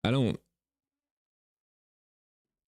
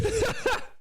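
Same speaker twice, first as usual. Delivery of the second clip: high laugh. Real, not acted. Laugh after joke.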